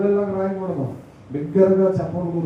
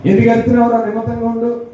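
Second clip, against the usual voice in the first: loud scolding shout; sharp rebuke; 7 dB louder, faster than usual. Retold or imitated. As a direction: bystanders rebuking the shouting blind man. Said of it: "గద్దించినప్పటికీ".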